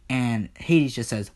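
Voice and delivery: ordinary delivery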